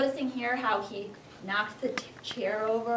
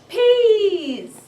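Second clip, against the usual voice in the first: high, loud, horrified wail on the word; dramatic peas; 9 semitones higher, 10 dB louder than usual. A narrator's reading. Teacher reading to class wails the hated vegetable. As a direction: cry out the dreaded word. Dramatic reveal in read-aloud.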